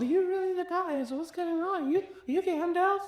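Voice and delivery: high, awed gamer voice; starstruck random player. Retold or imitated. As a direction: act like a stranger who can't believe it's Gandalf. Randoms react to Gandalf in game.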